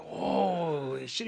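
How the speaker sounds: long, slow, dazed drawl; stunned awe. Retold or imitated. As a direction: stretch the words out, lost in amazement. Repeats stunned reaction.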